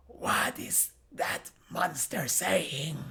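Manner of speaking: slow, soft stage whisper; hushed, whispery character voice; Jelit. Acted. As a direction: whisper the question, drawing out each word. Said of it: "Jelit speaks".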